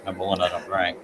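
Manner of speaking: ordinary talk, nothing marked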